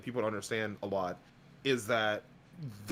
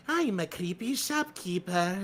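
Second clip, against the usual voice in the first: higher, slow creepy shopkeeper voice; 6 semitones higher, slower than usual. Acted. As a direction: lurk like a sly shopkeeper, slow. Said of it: "I like coming up with something just its, of its own".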